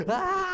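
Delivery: high piercing mock scream; imitating a blood-curdling scream; imitated scream. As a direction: let out a sudden high shriek. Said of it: "this lady screamed like".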